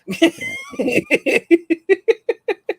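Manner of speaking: big high laugh. Real, not acted. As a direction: crack up at the punchline. Laughs at his own story.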